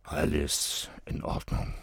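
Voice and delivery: soft, grumbled under breath. A narrator's reading. Father grumbling after checking the gifts, quiet.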